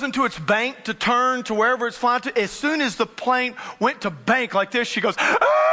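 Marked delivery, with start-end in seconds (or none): 5.2-5.7 very high, loud sustained scream; blood-curdling scream. Retold or imitated. Passenger as the plane banks, imitated blood-curdling scream. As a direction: imitate a terrified shriek. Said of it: "blood cur".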